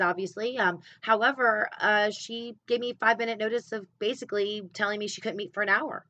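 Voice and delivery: normal talking voice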